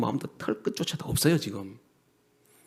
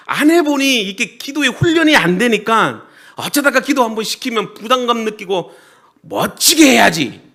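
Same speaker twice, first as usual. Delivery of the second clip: loud, raised-pitch pulpit delivery; forceful preaching; 9 semitones higher, 11 dB louder than usual. Real, not acted. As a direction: drive each point home loudly to the congregation. (힘주어 외치는 설교)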